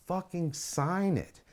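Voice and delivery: drawn out slowly, every word pressed; slow insistent demand. Retold or imitated. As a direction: wear him down word by word. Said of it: "all I said was".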